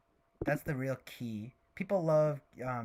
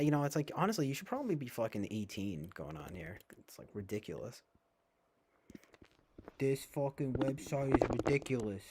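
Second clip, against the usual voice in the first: usual speaking voice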